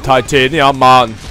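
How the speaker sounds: slow, botched Jamaican-Austrian accent; muddled Jamaican-meets-Arnold accent; Jamaican Arnold Schwarzenegger accent. Shown as a demonstration. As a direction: try a drawn-out Schwarzenegger with a Jamaican lilt. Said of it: "sounded like a Jamaican Arnold Schwarzenegger".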